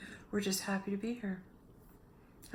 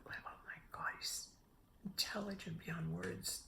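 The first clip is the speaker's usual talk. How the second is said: whisper; 10 dB quieter than usual. Real, not acted. Awed praise of her son.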